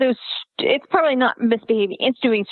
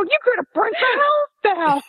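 laughing scold; 11 semitones higher than usual. Retold or imitated. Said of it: "I go".

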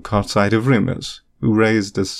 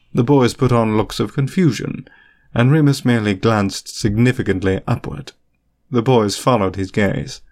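usual speaking voice

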